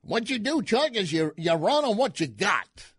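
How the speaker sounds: mock Cajun strategist lecturing the host; Carville impression. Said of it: "Carville told Chuck Todd".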